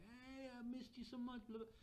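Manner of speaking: high, effusive friend's greeting; mock-gush like a long-missed friend. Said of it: "like, friend".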